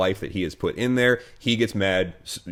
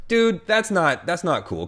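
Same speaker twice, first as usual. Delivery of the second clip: exasperated retort; 11 semitones higher, 3 dB louder, slower than usual. Retold or imitated. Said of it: "I'm just like".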